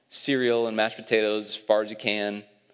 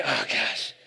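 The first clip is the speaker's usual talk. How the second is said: weary groan; 2 semitones lower than usual. Retold or imitated. Groggy father dreading getting up.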